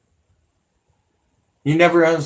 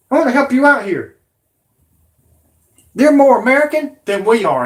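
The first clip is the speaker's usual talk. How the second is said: higher, louder and fast, shouting it out; angry shout; 7 semitones higher, 5 dB louder than usual. Real, not acted. Ranting at his viewers, angry shouting. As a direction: yell it at the critics in rising anger.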